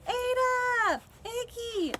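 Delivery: high excited cheer; bright crowd cheer; cheering names. A narrator's reading.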